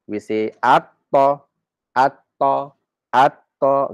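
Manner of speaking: at-ta slowly with a doubled t; at-ta with shaddah on the t; assimilated at-ta. Shown as a demonstration. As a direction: drill at-ta, no l. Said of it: "we don't say al-ta".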